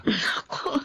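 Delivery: choked, breathy whisper of emotion; a near-sob as she starts to answer; tearful whisper. Acted. Ira moved to tears by his apology, whispering.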